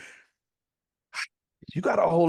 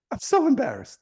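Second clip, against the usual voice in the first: mock mortified squeal, higher; hammed-up embarrassment, higher; 10 semitones higher than usual. Teller voices friend caught on video, high mock embarrassment. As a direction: play it up, pitched higher in shame. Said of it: "friend caught out in scene".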